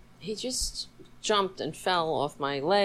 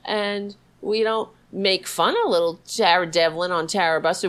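ordinary talk, nothing marked

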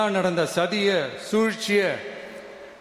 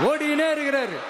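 higher shout, jeering; mocking shout; 7 semitones higher than usual. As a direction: call it out high to the crowd. Crowd-pleasing jab at rival.

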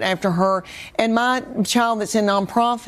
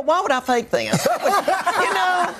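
laughing; 7 semitones higher than usual. Real, not acted. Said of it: "laughing about her real accent".

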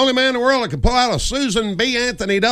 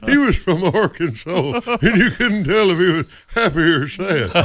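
slow, wavering Arkansas drawl. Acted. Comic recalling a country singer, slow Arkansas accent. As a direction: hover between happy and sad in Arkansas accent. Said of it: "[name] sort of had that".

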